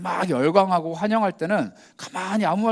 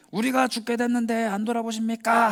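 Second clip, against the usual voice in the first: higher, pleading cry for help; panicked plea; 7 semitones higher than usual. Retold or imitated. Disciples in the storm begging Jesus, higher panicked plea. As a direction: beg him to wake up.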